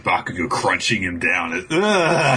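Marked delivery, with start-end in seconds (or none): 1.7-2.4 high strained grunt; crushed groan. Acted. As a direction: groan as if being squeezed. Imitates being crunched by flesh.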